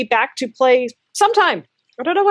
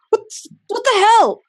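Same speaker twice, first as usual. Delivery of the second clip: startled outburst; 12 semitones higher than usual. Acted. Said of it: "Orbith kinda comes up and says".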